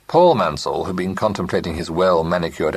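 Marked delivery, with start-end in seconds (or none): none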